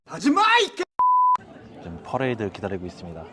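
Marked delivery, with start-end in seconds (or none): 0.1-0.8 high loud playful yell; mock shout. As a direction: yell it in fun, high-pitched. Mock scolding shout again. (장난스럽게 높이 외치는 소리)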